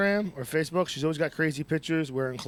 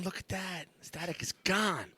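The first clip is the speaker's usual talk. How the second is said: normal talking voice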